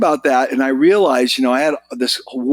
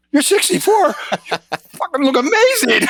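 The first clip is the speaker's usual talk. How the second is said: amazed disbelief; 14 semitones higher than usual. Retold or imitated. Retired kiter stunned at Paul's age, high incredulous exclamation.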